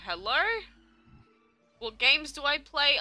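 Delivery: ordinary delivery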